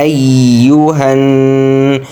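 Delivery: "أيها" running into a long nasal n; ghunnah. Shown as a demonstration. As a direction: slowly chant "أيها", humming the final n. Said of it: "এখানে কিন্তু গুণ্য হবে".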